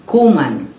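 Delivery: "Kuman" stressed on a lengthened "Ku"; first-syllable stress. Shown as a demonstration. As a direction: say "Kuman" with the weight on its opening "Ku". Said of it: "stressed on the first syllable".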